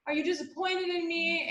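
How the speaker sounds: high, wobbly mock crying apology; imitating herself sobbing to her mother; retold bawling. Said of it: "I just started bawling".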